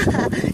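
brief amused chuckle; short laugh. Real, not acted. Vlogger joking about the view, short laugh. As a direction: chuckle briefly at your own joke. Laughs at comparing puddle to lake.

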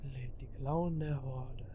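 eerie whisper. Acted. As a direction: breathe it out like a spooky secret. Reads game text eerily.

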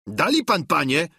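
high-pitched servant's protest; calling out. A narrator's reading. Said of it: "zawołał". (wołanie wysokim głosem)

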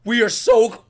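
high, loud, thrilled exclaiming; hyped excitement. Real, not acted. Viewer awaiting Naruto meeting Killer Bee, hyped excitement.

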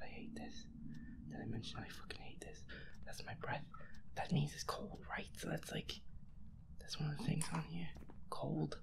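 ordinary talk, nothing marked

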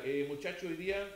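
half-hearted murmur to the players in the corner; listless team talk. Retold or imitated. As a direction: mumble it like a coach who doesn't care. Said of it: "parado así en la esquinita ... dando una charla".

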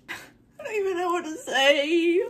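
high, loud tearful wail; real tearful crying. Real, not acted. Vlogger speechless over a gift, crying high and loud. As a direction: voice climbing as the tears break through. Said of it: "I feel like I could cry".

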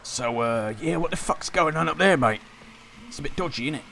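put-on London geezer accent; casual cockney lad voice; British accent. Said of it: "this voice is killing me".

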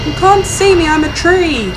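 high teasing sing-song; playful pretend hiding. Hiding from the monster, high playful taunt.

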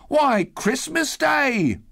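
put-on very high, drawn-out boyish exclamation; young boy. A narrator's reading. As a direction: answer as an astonished lad, stretching the words. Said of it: "replied the boy".

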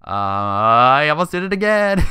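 loud uh climbing into a high exclamation; exasperated self-catch. Real, not acted. Gamer nearly says his filler word again, loudly exasperated. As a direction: stretch the uh, then cry out high.

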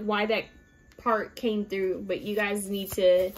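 ordinary delivery

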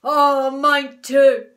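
loud, drawn-out dismayed shout; big character bellow of dismay. A narrator's reading. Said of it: "shouted Mister Twit".

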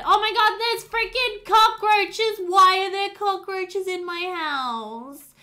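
high, loud and slow with horror; shocked outburst. Real, not acted. Player finds cockroaches in her game house, shocked and appalled. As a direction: recoil at the cockroaches in disgust.

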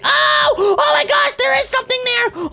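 high-pitched scared shriek; startled fright. Acted. Kid suddenly seeing something there, frightened. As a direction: jump in fright, voice shooting up.

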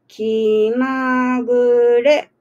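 一音ずつ長く伸ばして読む; 書き取れるようにごくゆっくり; ゆっくり読み上げ. Real, not acted. メールアドレスを読み上げる. (アドレスをゆっくり丁寧に読み上げる)

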